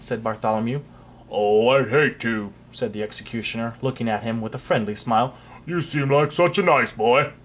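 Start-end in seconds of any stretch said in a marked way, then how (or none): 1.3-2.5 a hint of a slow, kindly executioner; lightly voiced mild, friendly headsman; a pleasant executioner reluctant to chop; gentle executioner. 5.6-7.3 lightly voiced mild, friendly headsman; a pleasant executioner reluctant to chop; gentle executioner.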